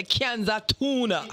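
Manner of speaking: slow, mock Jamaican "Kianza tuna"; quoting a comic's Jamaican line, drawn out; Jamaican accent. Jamaican comic imitates AG's Jamaican accent. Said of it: "'cause I like when you say".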